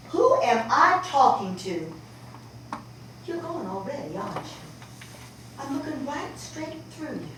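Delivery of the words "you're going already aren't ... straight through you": she barely gets it out to her daughter; near-whispered. Acted. Mother realizes daughter is gone.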